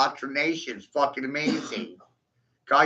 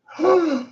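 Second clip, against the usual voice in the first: mock gasp; 14 semitones higher, 4 dB louder than usual. Real, not acted. Mock shock at own claim.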